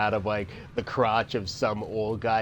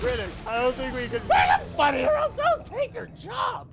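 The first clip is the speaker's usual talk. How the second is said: angry shout. Acted. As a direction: bark the threat. Mock director argument.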